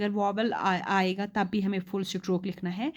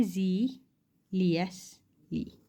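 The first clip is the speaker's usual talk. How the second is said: ज़ीलियसली drawn out very slowly; teacher's slow demo of ज़ीलियसली; slow model word; 2 semitones lower, 4 dB quieter, much slower than usual. Shown as a demonstration. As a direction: sound out ज़ीलियसली syllable by syllable. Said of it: "यहाँ पे ये word देखो".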